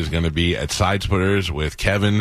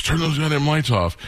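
imitated angry band member, higher pitch; mild mock yell; 10 semitones higher than usual. Band member orders the lighting guy around, high-pitched mock yell. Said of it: "the guy in the band yelled at him".

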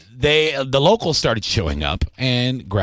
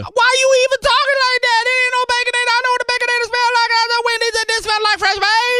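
excited neighbor yell; faster than usual. Acted. Host yells to his neighbor Ryan about the bacon smell. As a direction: call out to Ryan, thrilled by the smell.

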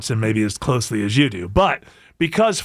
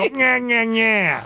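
put-on a lightly done Rochester accent, nasal-free and higher; mock Rochester accent; 5 semitones higher, much slower than usual. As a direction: tease the Rochester accent in a silly half-sung way. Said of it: "I just go".

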